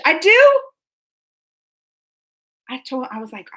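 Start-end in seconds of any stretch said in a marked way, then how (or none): 0.1-0.6 high, loud surprised question. Real, not acted. Told by a viewer she looks British, surprised. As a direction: shoot the pitch up in surprise.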